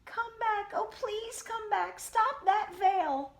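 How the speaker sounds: very high, slow pleading bride voice. A narrator's reading. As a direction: beg the veil to come back, high and drawn out. Bride chasing flying veil.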